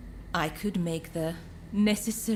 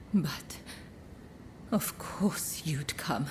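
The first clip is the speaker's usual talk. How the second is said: slow, hushed, lower and fond; tender whisper; 4 semitones lower, 4 dB quieter, slower than usual. Acted. Courtesan softly telling her rescuer she knew, tender whisper. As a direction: whisper it as if it were obvious to you.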